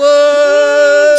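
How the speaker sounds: loud high shocked cry. Real, not acted. Reacting in loud disbelief to the reveal.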